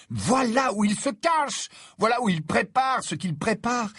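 high, ringing exclamation; excited realisation. A narrator's reading. Harry telling Ron and Hermione his discovery, excited.

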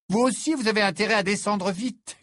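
lilting mock ballerina voice; imitating Fleur. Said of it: "dans une très bonne imitation de Fleur".

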